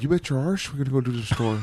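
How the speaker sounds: exaggerated Irish accent. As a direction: imitate Irish talk with a put-on brogue. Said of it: "Irish talk like".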